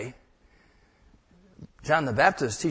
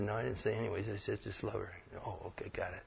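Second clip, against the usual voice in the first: quiet retold dialogue; 5 semitones lower, 12 dB quieter, faster than usual. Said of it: "He said".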